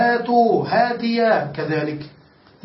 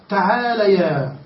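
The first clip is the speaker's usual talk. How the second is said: slow demo; 2 semitones lower, slower than usual. Shown as a demonstration. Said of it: "تقول".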